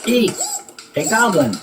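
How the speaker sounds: the campers shrieking together at a goblin. Acted. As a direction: squeal in fright at a goblin. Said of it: "everyone shrieked".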